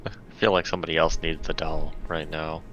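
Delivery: ordinary delivery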